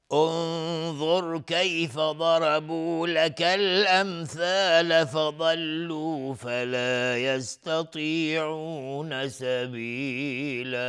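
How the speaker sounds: slow, high chanted recitation of the verse; Quran recitation. Shown as a demonstration. Teacher reciting a verse to the class, slow and chanted. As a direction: recite it slowly in full tajwid style.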